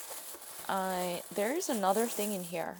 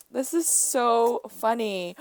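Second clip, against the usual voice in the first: usual speaking voice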